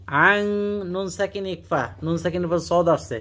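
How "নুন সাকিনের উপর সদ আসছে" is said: ordinary talk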